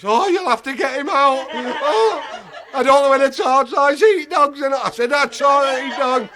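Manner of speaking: high, loud pleading wife; laughing mock-tearful wife. Tearful wife begs him to free the dog, high and loud.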